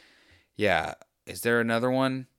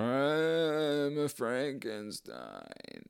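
mock movie-monster drone, slow and quiet; lumbering Frankenstein; 5 semitones higher, 4 dB quieter, much slower than usual. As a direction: drag it out like a stiff movie monster. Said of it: "just the thing with his arms out going".

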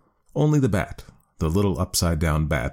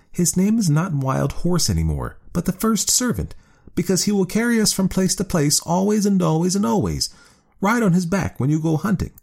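much higher, bright proud wife; high woman's voice, pleased; 12 semitones higher than usual. A narrator's reading. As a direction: raise the pitch high as the woman. Woman character voice.

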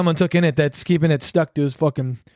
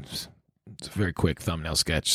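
ordinary talk

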